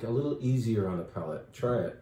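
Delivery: ordinary talk, nothing marked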